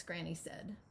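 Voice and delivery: lively picture-book read-aloud; expressive storybook narration; reading to children. Acted. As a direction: read it as if to a room of kids.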